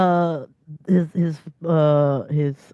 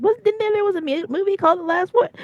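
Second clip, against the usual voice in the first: much higher, quick, amazed; high excited quote. Retold or imitated. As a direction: squeak it out in disbelief. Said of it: "I was like".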